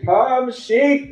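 high, calling to the flock; shepherd boy's holler. Retold or imitated. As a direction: call the sheep like a shepherd boy. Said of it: "And he had a certain holler".

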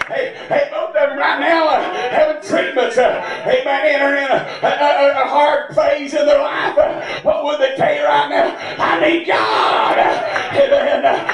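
ordinary talk

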